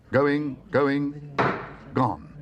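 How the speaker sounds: high, loud, drawn-out calls; auctioneer's going gone. Acted. Auctioneer closes the sale on the bear. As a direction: bring the hammer down with your voice.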